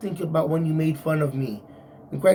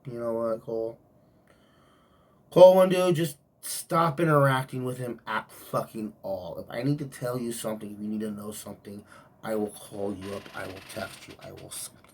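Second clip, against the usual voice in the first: calm boss; 7 semitones lower than usual. Retold or imitated. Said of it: "And he's like".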